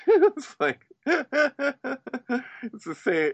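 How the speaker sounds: high laugh through the words; laugh, then get the words out; real high laughter. Real, not acted.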